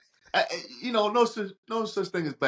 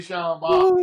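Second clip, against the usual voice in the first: high, loud, half-sobbing with laughter; crying laughing; 13 semitones higher, 8 dB louder, slower than usual. Real, not acted. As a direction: laugh till it breaks. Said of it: "it's that accent".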